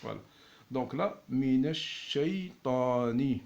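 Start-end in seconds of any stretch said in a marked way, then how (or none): 1.3-3.4 "minash shaytani" slow, shin held long; slow doubled shin. Shown as a demonstration. Teacher to students, "minash shaytani" slow with the shin held.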